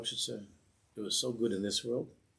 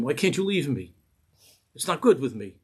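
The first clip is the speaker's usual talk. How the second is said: louder, higher angry outburst; screaming at him; 4 semitones higher, 8 dB louder than usual. Retold or imitated. Rebbe screaming at the chassid on the table, loud and angry. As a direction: yell the challenge at him. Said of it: "the Rebbe screamed at him".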